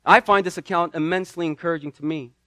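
normal talking voice

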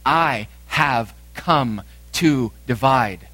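"I have come to divide" one word at a time; slow, separate beats on "I have come to divide"; clear and emphatic. Acted. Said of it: "Jesus is speaking clearly and emphatically".

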